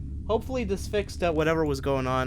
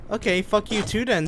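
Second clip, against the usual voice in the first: raised, irritated retort; annoyed snap; 7 semitones higher, 4 dB louder than usual. Real, not acted. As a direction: snap back at the game. Insults the game host.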